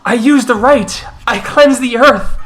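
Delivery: high-pitched, urgent protest; pleading accused Gorel. Acted. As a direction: plead your innocence before a hostile crowd. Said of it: "As the Gorel goes".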